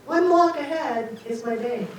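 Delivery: ordinary talk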